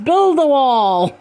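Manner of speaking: slow, high war cry; shriek. A narrator's reading. Masked men shaking their weapons, drawn-out shriek. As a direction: stretch the slogan into a mob's battle cry. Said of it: "they shrieked".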